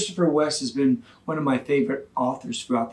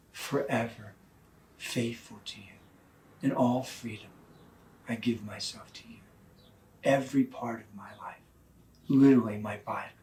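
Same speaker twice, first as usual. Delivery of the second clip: tender hushed vow; 3 semitones lower, 5 dB quieter than usual. Retold or imitated. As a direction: breathe it like a sacred vow. Husband reciting vows, tender, hushed.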